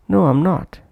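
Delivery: "I'm" reduced between a high "No" and "not"; weak-form "am". Shown as a demonstration. Teacher demonstrating the weak form in an answer, "I'm" not prominent. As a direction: keep the "am" in "I'm" short and unstressed. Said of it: "that's why not pronounced prominently".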